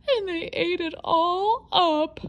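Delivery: high, slow, crying little bear; squeaky crying cub. Acted. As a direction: cub bursting into tears. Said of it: "in her high, squeaky voice".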